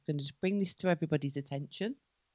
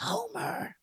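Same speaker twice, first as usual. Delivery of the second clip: higher, loud Homer Simpson impression; 7 dB louder, much slower than usual. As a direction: do a goofy Homer voice. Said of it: "call her Marge".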